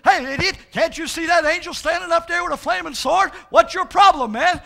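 the donkey scolds, loud and high; scolding donkey. Acted. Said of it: "And he says".

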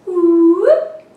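loud, high playful whoop; excited whoop. Acted. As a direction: whoop as the bug flies. Bug flies up.

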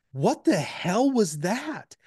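slow, high-pitched mock disbelief; incredulous boss, retold with drawn-out words; appalled boss. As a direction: imitate a boss drawing out her dismay. Boss's appalled reaction retold.

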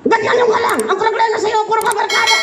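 high nagging wife impression. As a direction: whine like a scolding wife. Wife's voice, high.

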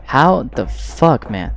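slow disbelieving yell; angry rant. Real, not acted. Gamer venting at the stream, slow angry disbelief. Raging at game.

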